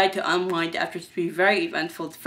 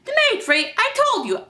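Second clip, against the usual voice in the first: very high-pitched protest; exasperated; 3 dB louder than usual. Acted. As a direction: protest to a pestering caller. Exasperated excuse on the phone.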